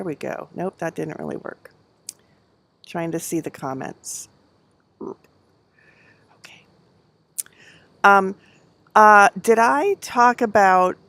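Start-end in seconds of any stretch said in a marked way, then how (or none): 5.0-6.7 barely voiced whispered okay. Real, not acted. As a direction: breathe the word out under your breath. Quietly checking comments.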